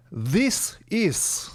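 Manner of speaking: "This is" as Russian-accented "zees ees". Shown as a demonstration. Teacher showing how Russians say it, Russian accent. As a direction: imitate a Russian saying "This is". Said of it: "Russians will say".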